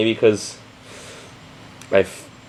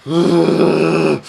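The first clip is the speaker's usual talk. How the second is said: exasperated sigh; 4 semitones higher than usual. Real, not acted. Viewer fed up with a stupid episode, exasperated sigh.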